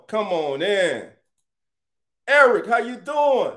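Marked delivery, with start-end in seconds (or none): 2.3-3.5 high, loud shout-out; hype greeting. Real, not acted. Streamer greeting Eric as he joins the chat, hyped. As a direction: welcome Eric in loudly.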